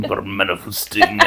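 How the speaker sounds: amused quiet repeat; laughing. Retold or imitated. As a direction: giggle it back quietly. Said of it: "echoes the failed accent".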